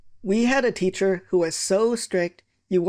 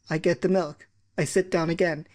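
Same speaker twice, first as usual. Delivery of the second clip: usual speaking voice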